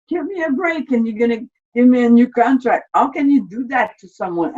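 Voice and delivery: high, loud, fast exasperated protest; retold indignant outcry. Soldier protesting his discharge, high and exasperated. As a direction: burst out in disbelief at the injustice.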